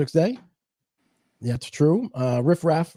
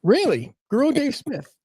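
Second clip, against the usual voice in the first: surprised disbelief; 10 semitones higher than usual. Real, not acted. Incredulous repeat of odd name.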